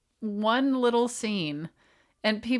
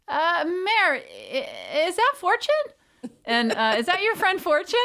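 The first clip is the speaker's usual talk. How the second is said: put-on kids' voices; 7 semitones higher than usual. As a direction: imitate small kids asking. Kids quoted asking.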